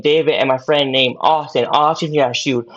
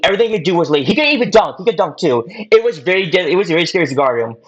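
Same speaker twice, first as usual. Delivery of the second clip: normal talking voice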